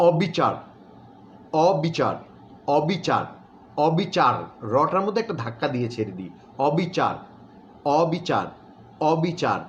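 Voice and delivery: অবিচার with a small stop on the final র; pronunciation demo. Shown as a demonstration. Teacher demonstrating অবিচার with the র cut short. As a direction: say অবিচার ending in a short clipped র. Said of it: "একটা ছোট্ট করে ধাক্কা দিই".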